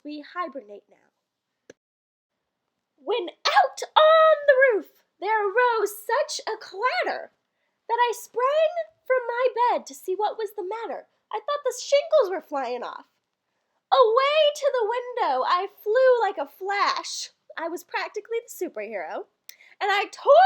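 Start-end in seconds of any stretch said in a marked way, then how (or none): 3.0-11.1 louder, building verse reading; dramatic poem recital. Acted. Telling the Christmas poem's rooftop clatter, dramatic recital. 13.9-17.3 louder, building verse reading; dramatic poem recital. Acted. Telling the Christmas poem's rooftop clatter, dramatic recital.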